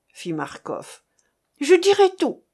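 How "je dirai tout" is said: high, loud cry of surrender; panicked exclamation. A narrator's reading. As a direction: blurt it out in panic, pitched up. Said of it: "s'écria Carfor". (cri affolé, aigu et fort)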